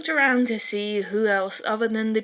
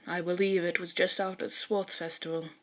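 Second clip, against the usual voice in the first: quiet, lower, composed Tokoyami; low calm reply; 4 semitones lower, 6 dB quieter than usual. Acted. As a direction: state it plainly and softly.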